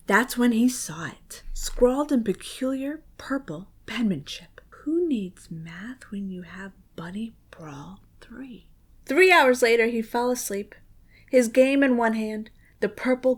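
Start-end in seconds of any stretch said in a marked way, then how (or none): 4.8-8.7 much softer, lower whispered message; creepy crayon whisper; eerie whisper. A narrator's reading. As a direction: hiss it like a secret tempting voice. Crayon's message read eerily.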